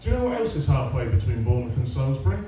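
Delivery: normal talking voice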